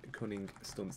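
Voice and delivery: carefully spaced-out title; slow, careful. Real, not acted. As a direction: say it slowly to avoid a slip. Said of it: "I always have to say that slow".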